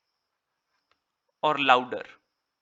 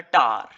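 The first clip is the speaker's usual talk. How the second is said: "tar" louder, with a full long A; strong syllable demo; 7 semitones lower, 6 dB louder than usual. Shown as a demonstration. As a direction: set "tar" apart and stress its whole vowel. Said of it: "A is a complete sound here".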